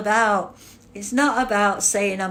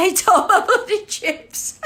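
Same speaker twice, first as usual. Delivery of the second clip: laughing; 9 semitones higher, 3 dB louder than usual. Real, not acted. Cracks up reading chat joke.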